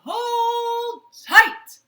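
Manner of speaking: high, loud shout of the magic words; yell. Acted. Peter yells the magic words at the goose, loud high shout. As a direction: belt out the spell. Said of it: "and Peter yelled".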